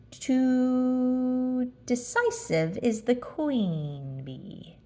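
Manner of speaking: very slow, stretched words; drawn out. Acted. Pooh fumbling the sentence, very slow. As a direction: stumble slowly through the phrase.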